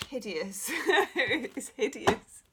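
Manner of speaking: laughing through the words; chuckling as she says it. Real, not acted. Laughing about own renovation.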